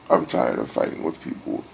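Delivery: normal talking voice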